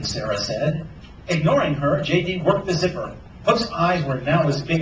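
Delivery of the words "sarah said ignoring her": ordinary delivery, nothing marked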